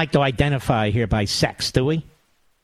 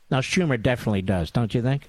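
ordinary talk, nothing marked

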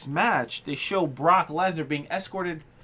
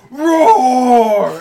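loud, high mock roar; imitated scream; 7 semitones higher, 12 dB louder than usual. Wrestler lifts the limo, hammed-up screaming roar. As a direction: bellow it like a raging giant. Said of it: "and then screams".

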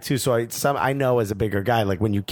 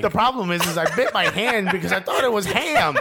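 laughing self-mockery; 6 semitones higher than usual. Mocking himself as greedy fat guy.

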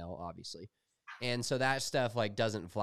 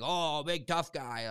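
slow, higher-pitched mocking Boston jeer; lightly imitated Boston heckler; mock Boston accent; 5 semitones higher, slower than usual. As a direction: taunt like a Boston loudmouth, drawn out. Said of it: "he was a big Boston accent guy".

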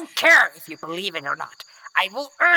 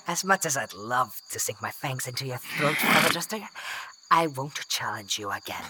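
low, quiet concession; grudging surrender; 6 semitones lower, 7 dB quieter than usual. Acted. Captive to the captor holding the Athwar, grudging surrender. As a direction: give in under your breath, resentful.

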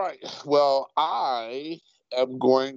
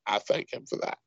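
usual speaking voice

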